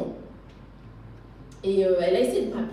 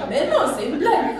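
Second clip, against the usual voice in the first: louder, laughing, it can't be true; laughing disbelief; 6 semitones higher, 6 dB louder than usual. Real, not acted. Disbelief at friend's news.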